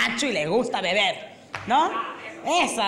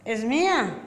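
put-on short, slurred-style drunk reply; lightly voiced drunk owning up; drunk man; slower than usual. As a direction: play a tipsy dog owner. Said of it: "Y el borracho dice".

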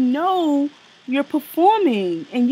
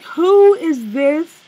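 surprised; 6 semitones higher, 7 dB louder, much slower than usual. Retold or imitated. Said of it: "I was like".

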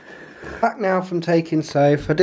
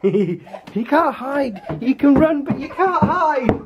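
higher, slow sing-song tease; playful teasing; 12 semitones higher, much slower than usual. Real, not acted. Teasing hiding child.

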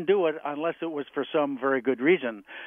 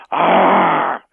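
loud roaring Ah like a lion; 2 semitones higher, 9 dB louder than usual. Retold or imitated. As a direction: let out a big lion's roar. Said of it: "like a lion".

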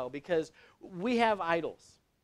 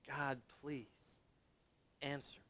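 soft, low acted plea to God; hushed pleading prayer; 3 semitones lower, 14 dB quieter than usual. Prophet on the mountain begging God to answer, hushed plea. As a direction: pray it quietly, pleadingly. Preacher acts out Elijah praying.

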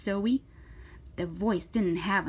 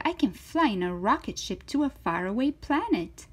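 higher, animated storybook voice; lively, swooping story read; reading to children; 4 semitones higher, 7 dB louder, faster than usual. Acted. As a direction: voice an eager, confident little girl. Reads to children, voicing Zoe.

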